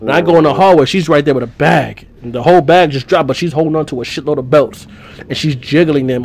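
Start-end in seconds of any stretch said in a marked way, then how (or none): none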